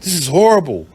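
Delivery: mock shocked cops. Police reacting to the girlfriend's story, hammed-up shock. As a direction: ham up the officers' horror. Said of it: "And so they like".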